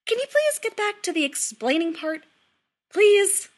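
high, loud, frantic plea; near hysterical. A narrator's reading. Son begging his mother to explain, near hysterical. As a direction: plead with rising desperation. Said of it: "just this side of hysterical".

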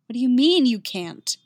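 stunned disbelief; shocked. Acted. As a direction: demand it in disbelief. Yennefer shocked, bile rising.

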